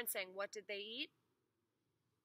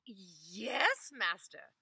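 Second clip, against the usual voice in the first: eager; 8 dB louder, much slower than usual. Acted. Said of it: "said the wife eagerly".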